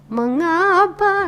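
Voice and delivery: "Mengapa" climbing high, drawn out; rising intonation. Shown as a demonstration. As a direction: lift the tune slowly through "Mengapa". ("Mengapa" panjang dengan nada naik)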